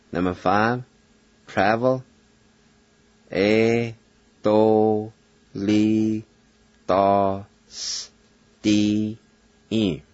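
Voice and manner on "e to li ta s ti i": E to li ta s ti i, one syllable at a time; Cherokee pronunciation. Shown as a demonstration. Teacher sounding out the word for travel. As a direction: sound out E to li ta s ti i slowly.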